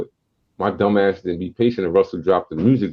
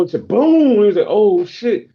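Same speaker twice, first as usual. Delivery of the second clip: much higher, sudden startled boom and gasp; startled gunshot; 11 semitones higher, 5 dB louder than usual. Retold or imitated. Kid startled when the gun fires, much higher. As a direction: jolt at the accidental shot.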